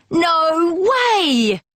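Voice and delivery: squeaky mock cat protest; cats calling. A narrator's reading. Street cats call out against the goal. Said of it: "call the street cats".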